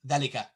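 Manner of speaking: dhalika said really fast; short vowel. Shown as a demonstration. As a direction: clip the first a of dhalika short. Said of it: "really fast like that".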